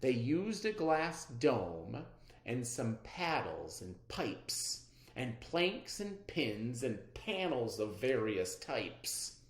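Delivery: usual speaking voice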